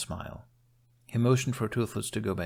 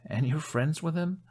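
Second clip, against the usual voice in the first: surprised; 8 semitones higher than usual. A narrator's reading. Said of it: "surprised Hiccup with a small laugh".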